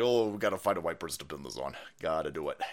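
low, quick scheming aside; conspiring mock quote. Host voicing plotters hunting for a scapegoat, low conspiring tone. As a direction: act out plotters muttering low among themselves. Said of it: "they're like".

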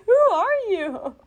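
drawn-out, high-pitched baffled question; baffled disbelief. Retold or imitated. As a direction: stretch it out high, as if totally thrown. Baffled reaction to unknown interviewer, acted out.

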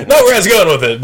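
laughing high and loud while talking; giggling it out. Real, not acted. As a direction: crack up through the words. Laughs at misheard punchline.

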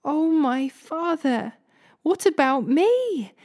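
high, drawn-out anguished plea; narrated wail of bitter pleading; bitter cry. A narrator's reading. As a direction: cry out slowly and high, begging in acted anguish. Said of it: "let out a loud and bitter cry".

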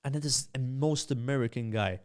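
usual speaking voice

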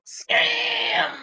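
high screeching villain shout; hammed-up high-pitched scream; Starscream. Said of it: "his star scream".

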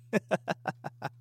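light, high chuckle; laughing. Real, not acted. Podcaster after his mock outburst, chuckling. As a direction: giggle softly at your own outburst.

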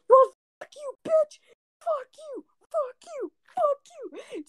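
very high falsetto cursing fit; mad outburst. Retold or imitated. Retelling a friend losing it at everyone, mock furious squeal. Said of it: "he was so mad".

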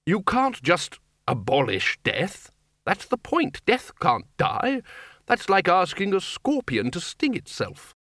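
slow, high-pitched emphatic argument; insistent old academic, voice climbing; exasperated senior wizard. A narrator's reading. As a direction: hammer the point home, drawn out. Voices the exasperated Arch-Chancellor in story.

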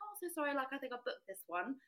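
sweetly apologetic English politeness; asking a stranger for her booked seat; polite apology. Retold or imitated. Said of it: "and I was like".

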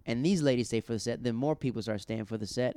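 ordinary talk, nothing marked

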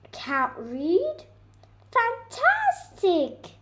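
Fantastic stretched high and slow; delighted bird. Acted. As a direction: gush like a thrilled little teacher bird. Bird delighted at non-reader.

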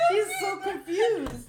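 high, slow words through laughing; really laughing, nearly wailing; helpless laughter. Real, not acted. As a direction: squeal it out while cracking up. Cracking up over confused dog.

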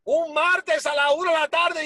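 ordinary talk, nothing marked

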